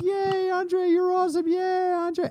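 very high, gushing fan cheer; mock crowd cheer. Host imitating cheering wrestling fans, high and gushing. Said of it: "everybody's cheering and like".

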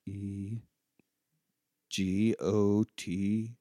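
letters read out very slowly; spelling the board's message, very slow; spelled out slowly. Pretend. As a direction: drag each letter like a Ouija planchette.

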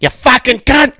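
high, loud Aussie yell; hammed-up Australian abuse; mock Aussie heckler. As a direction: scream it like a drunk fan in the stands. Friend joins mocking drunk heckler.

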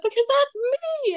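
high mock wail; retold crying. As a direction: whine it as if bawling. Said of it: "like, crying".

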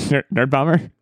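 a squeaky break while calling "Nerd Bomber"; voice crack. Real, not acted. Host's voice cracks naming co-host.

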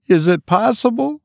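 high, outraged cry of a wife; wife cries out to her brother in outrage; cried out high. A narrator's reading. Wife to brother, high outraged cry. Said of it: "cried the wife".

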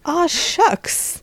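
high, aw-shucks Texan; Texas accent. Acted. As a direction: play a bashful Texas cowboy. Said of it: "I'll pretend I'm from Texas".

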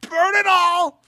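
joyful mock battle cry; gleeful high shout. Real, not acted. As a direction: cheer it up high, like a villain celebrating. Cheering chaos on the show.

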